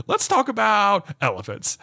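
put-on high, earnest little-kid voice; toddler voice. Dad imitates his little son, high and childlike. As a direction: play the small son asking. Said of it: "be like".

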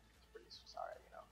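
whispering. Real, not acted. Remark on song.

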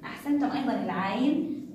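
ordinary delivery

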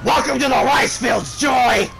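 loud, shouted mock welcome; hammed-up shout. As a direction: belt it out as a mock greeting. Yells meme after fumbled tries.

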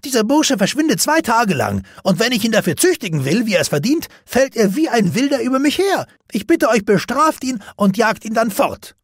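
high, loud outraged servant; angry complaining. Acted. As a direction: whine furiously to your mistress. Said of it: "Voller Wut ... beklagte sich".

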